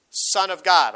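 booming pulpit declaration; proclaimed. Acted. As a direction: ring it out. Climax of centurion quote.

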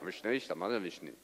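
low, soft mock-Russian mumbling; deadpan gibberish after a big shout; monotone. Translator rendering the sermon into Russian, low monotone. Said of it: "monotone in the way that he spoke".

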